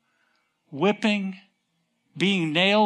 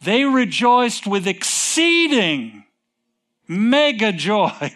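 high, drawn-out jubilant proclamation; loud rejoicing; 4 semitones higher, 4 dB louder, slower than usual. Acted. Preacher retelling the wise men's mega joy, loudly exultant. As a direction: proclaim it big and slow, anything but quiet. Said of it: "They weren't quiet about it".

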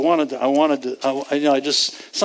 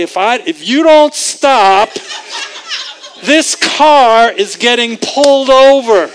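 loud exasperated warning; fed-up parent threat; 5 semitones higher, 7 dB louder than usual. Retold or imitated. Driver warning the whining kids, loud and fed up. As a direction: snap it like a driver at the end of his rope. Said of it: "you want to say".

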